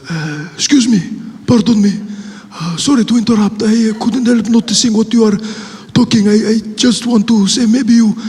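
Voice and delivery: put-on Middle Eastern accent. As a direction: politely butt in with a halting Middle Eastern accent. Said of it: "a Middle Eastern man".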